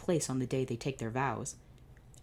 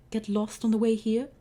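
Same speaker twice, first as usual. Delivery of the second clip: higher, louder mocking jab; sneering villain; 8 semitones higher, 6 dB louder than usual. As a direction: taunt him for being late.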